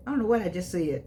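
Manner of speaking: ordinary talk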